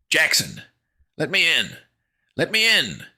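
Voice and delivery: high, loud plea; frantic repeated yell; desperate shouting. A narrator's reading. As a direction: shout desperately through the door. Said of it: "desperate shouting".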